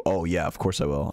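normal talking voice

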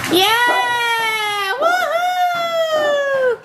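very high cheering; excited cheer. Real, not acted. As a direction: cheer for Daddy. Cheering as Daddy arrives.

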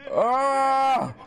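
sudden terrified scream; startled high scream. Real, not acted. Scared by enemy in cave.

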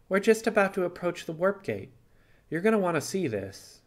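can't wait to show her; excited to show her the warp gate; eager. Acted. Simon eager about warp gate.

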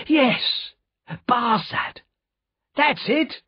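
excited cry. A narrator's reading. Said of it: "cried Jerry".

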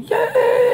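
high piercing mock scream; sudden very high yell; banshee scream. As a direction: shriek it like a banshee. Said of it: "I scream like a, a banshee".

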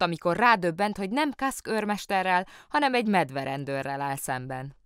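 ordinary delivery

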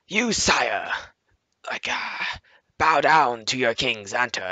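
mock-regal proclamation, drawn out; self-styled king. Player king orders a newcomer to bow, mock-regal voice. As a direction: proclaim it like a pretend monarch. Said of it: "there's the fucking king".

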